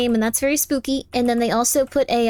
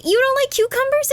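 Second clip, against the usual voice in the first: squeaky incredulous question, pitched way up; mock surprise; 12 semitones higher than usual. Streamer teasing chat, mock-shocked and pitched high. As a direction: act shocked that anyone dislikes cucumbers.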